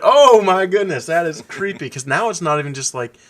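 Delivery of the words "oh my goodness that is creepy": freaked out. Retold or imitated. Recalling the clown turning angry, high loud spooked exclamation. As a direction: drag it out, spooked and thrilled. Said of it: "I was like".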